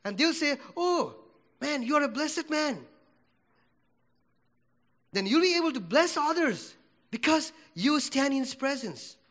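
ordinary talk, nothing marked

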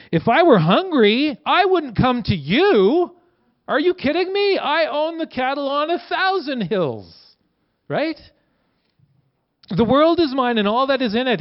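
high, incredulous, emphatic voice; God scoffing at the very idea; God voiced indignantly. Preacher voicing God in Psalm fifty, high and incredulous. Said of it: "Psalm fifty says".

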